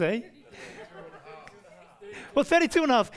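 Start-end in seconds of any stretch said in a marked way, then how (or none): 2.3-3.1 jokey raised voice. Real, not acted. Joking correction with congregation.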